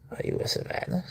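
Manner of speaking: high soft whisper to the cat; whispered coo. Real, not acted. Owner whispers the question to the cat. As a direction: ask it in a gentle whisper.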